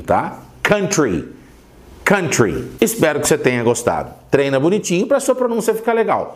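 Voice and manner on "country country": country said the English way, KUN-tree; English pronunciation. Shown as a demonstration. Teacher closing with the correct English KUN-tree. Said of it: "vai pronunciar bonitinho".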